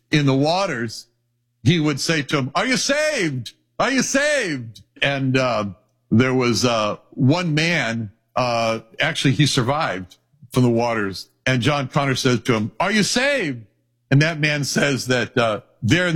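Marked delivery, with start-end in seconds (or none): 2.5-4.8 high, urgent calling across the water. Retold or imitated. Preacher voicing a man in icy waters, calling out high. 12.8-13.6 calling out. Retold or imitated. Calling out to survivor.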